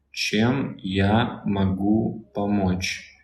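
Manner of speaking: чем я могу помочь said slowly and clearly; slow Russian demo. Shown as a demonstration. Teacher models чем я могу помочь slowly for a viewer. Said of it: "how can I help you".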